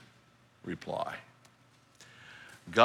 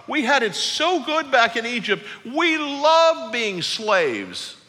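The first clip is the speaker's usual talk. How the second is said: higher, louder whiny complaint; hammed-up whining crowd; whining Israelites; 11 semitones higher, 5 dB louder, slower than usual. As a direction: whine at Moses like spoiled complainers. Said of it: "And they whine".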